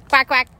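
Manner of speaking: quack. Pretend. Imitating ducks.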